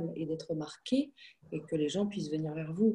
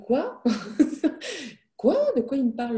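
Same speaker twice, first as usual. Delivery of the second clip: a laughing, much higher "quoi?" that jumps up again, louder; baffled surprise; 8 semitones higher, 5 dB louder than usual. Retold or imitated. Retelling her reaction to the landlord in the suit, in a laughing high-pitched surprised "quoi?". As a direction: replay the moment the remark caught her off guard.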